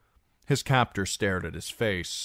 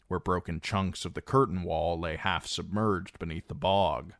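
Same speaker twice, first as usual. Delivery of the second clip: usual speaking voice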